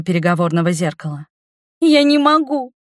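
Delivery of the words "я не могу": high sobbing wail; snotty crying. Acted. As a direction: sob it out, sniffling. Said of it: "всхлипнула она, шмыгая носом".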